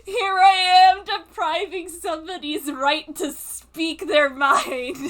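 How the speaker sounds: loud, hammed-up mock outrage; overdramatic. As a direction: play the outrage up for laughs. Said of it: "he's being so overdramatic".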